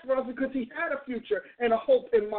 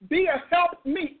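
normal talking voice

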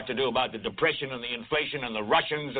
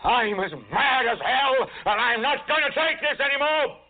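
yell; 7 semitones higher than usual. Acted. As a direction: bellow it into the street. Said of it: "stick your head out and yell".

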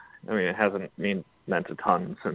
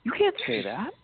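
mock outrage; 13 semitones higher than usual. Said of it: "They're like".

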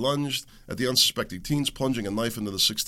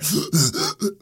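mock gasp; 7 semitones higher, 4 dB louder than usual. Imitating dying man.

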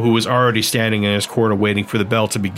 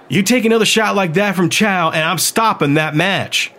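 much higher, firm, slowing female voice; firm woman; 6 semitones higher than usual. Acted. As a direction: lay down the law, no arguing. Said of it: "Emmeline said firmly".

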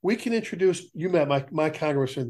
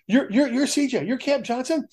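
higher, bubbly fawning rookie voice; eager young firefighter; 8 semitones higher than usual. Retold or imitated. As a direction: gush over the old chief like a starstruck kid. Young firefighters fawning over retiree.

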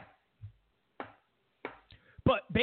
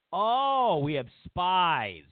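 mock amazement; 3 dB louder, much slower than usual. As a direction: echo the secret with sarcastic awe. Mocking echo of the secret.

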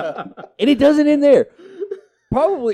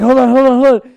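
higher, louder desperate "hold on"; strained urgent plea; 7 semitones higher, 9 dB louder, faster than usual. Retold or imitated. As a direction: beg for a moment mid-crisis. Friend straining on toilet.

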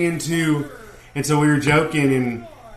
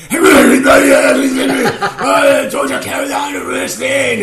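put-on much higher and louder, a shouted Russian-accented wrestling promo; Nikita Koloff promo voice; 12 semitones higher, 9 dB louder than usual. Wrestler shooting a promo for their TV show, shouted in his Russian accent. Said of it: "like, my accent?".

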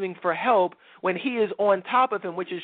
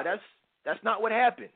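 ordinary talk, nothing marked

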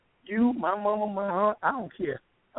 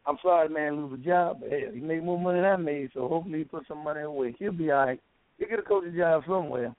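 ordinary talk

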